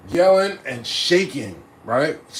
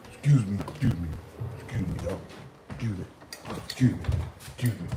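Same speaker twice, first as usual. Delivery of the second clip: much lower, softer mumbled excuse me; sheepish excuse me; 10 semitones lower, 9 dB quieter, faster than usual. Retold or imitated. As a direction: slink out murmuring excuse me. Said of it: "I'm walking out like".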